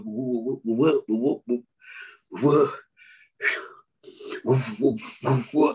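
stuttering imitation. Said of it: "He goes".

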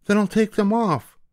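mother demanding at the witch's hut, very high and loud; calling out. Acted. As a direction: demand at the door. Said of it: "the mother said".

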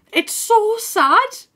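slow, high, whiny lament; high mock wail. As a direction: wail it like fake crying.

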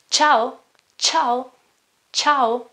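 "ciao" said separately, tongue high on the ch; Italian ch demo. Shown as a demonstration. Teacher modelling the ch in ciao, careful pronunciation. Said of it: "put your tongue higher and say ch".

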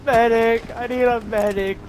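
high loud call for a medic; man down shout. Acted. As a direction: scream for a medic, wounded. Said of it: "I was screaming medic".

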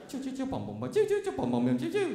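high-pitched video game zaps and bangs; imitated game noises. Killing time with a game while waiting, imitated beeps. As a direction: squeak out rapid arcade sound effects.

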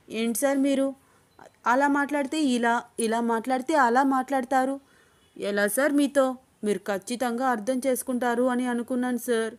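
plain reading voice

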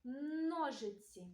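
"Ножиці" with a long held initial N; slow "Ножиці", first consonant stretched; sound-highlighting pronunciation. Shown as a demonstration. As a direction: linger on the Н in "Ножиці". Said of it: "та відстежимо спільний звук".

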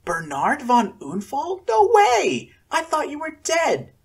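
high, loud, disbelieving joy; excited. A narrator's reading. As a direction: cry out at a friend returned. Joyful reunion with old friend.

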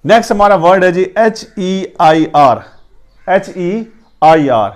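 ordinary talk

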